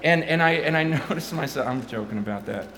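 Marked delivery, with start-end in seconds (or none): 0.0-1.7 rising, louder preacher voice; ramped-up sermon delivery; preaching. Acted. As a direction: build into full preaching force. Preaching build, loud.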